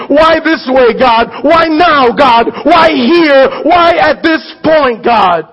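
anguished outcry of why; crying out to God. Acted. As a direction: shout the questions up at God. Preacher voicing a cry to God.

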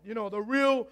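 ordinary talk

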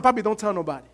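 Jesus quietly warning the healed man; hushed aside. Retold or imitated. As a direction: keep it between us, low.

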